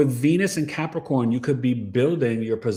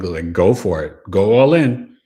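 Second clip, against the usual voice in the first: ordinary delivery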